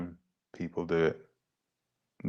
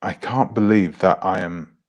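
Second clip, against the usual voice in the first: normal talking voice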